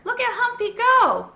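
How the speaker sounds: put-on high, loud, delighted little boy; excited child. A narrator's reading. Carlos watching the hamster roll, delighted squeal.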